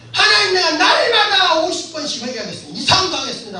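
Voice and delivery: high, loud tearful vow; crying out to God. Retold or imitated. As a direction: wail out a promise while weeping. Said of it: "라고 막 소리치기 시작했어요".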